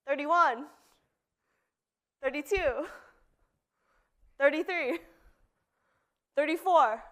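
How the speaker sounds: counting workout reps. Real, not acted. Counts push-ups aloud.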